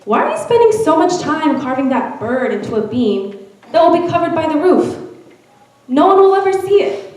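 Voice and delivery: mildly baffled, pitch climbing toward the end; lightly acted bewilderment; puzzled questioning. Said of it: "He was puzzled and asked the man".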